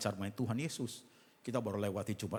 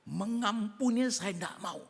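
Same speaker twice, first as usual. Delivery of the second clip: higher, slow, emphatic refusal; stubborn refusal; 11 semitones higher, 6 dB louder, slower than usual. Acted. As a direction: dig in and refuse. Congregant refusing to forgive.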